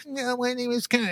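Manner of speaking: high, small, whiny giggle into a mock introduction; Kamala Harris. Mocking a politician on a podcast, whiny impression. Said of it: "in her little whiny little voice".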